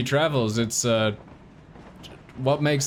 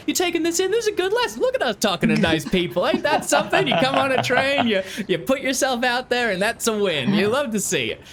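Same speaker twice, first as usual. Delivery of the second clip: proud father; 11 semitones higher than usual. Acted. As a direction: hype up your boy like a proud father. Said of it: "It's sort of like a".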